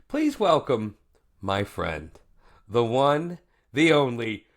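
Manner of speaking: host introducing the guest chef, building to a shout; announcer intro. Acted. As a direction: hype the guest in like a ring announcer.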